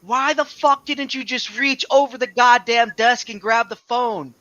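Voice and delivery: loud, high, drawn-out exasperation; retold yelling. Husband re-enacting scolding his wife over wet floor, exasperated yell. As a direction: re-enact yelling at someone in disbelief. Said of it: "I'm yelling at her like that".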